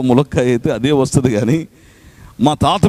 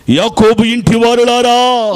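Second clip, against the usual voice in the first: forceful preaching; 9 semitones higher, slower than usual. Acted. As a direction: call it out to the whole church. Proclaims scripture verse. (బలంగా ప్రకటన)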